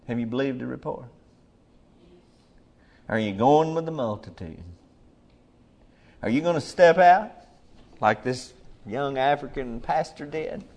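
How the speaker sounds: ordinary delivery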